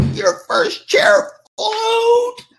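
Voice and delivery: very high, slow, crying outcry; exasperated wail. Retold or imitated. Aunt outraged that first chair switched, high wailing cry. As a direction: wail it in disbelief.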